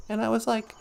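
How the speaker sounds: ordinary talk, nothing marked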